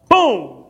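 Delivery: sudden loud boom; imitated boom. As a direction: punch out the breakdown noise. Imitates the car breaking down.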